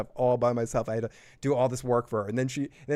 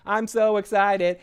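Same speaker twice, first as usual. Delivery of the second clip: mock excitement; 8 semitones higher, 6 dB louder, slower than usual. Mocking excited queen.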